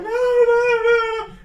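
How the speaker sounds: high, loud wailing no; shrieking women. Acted. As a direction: shriek the protest. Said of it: "they're like".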